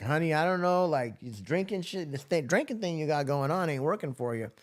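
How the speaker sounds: a hint of a blunt nurse. Nurse scolding the drunk patient, lightly voiced. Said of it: "And she's like".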